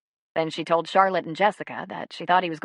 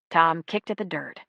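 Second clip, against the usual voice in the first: ordinary delivery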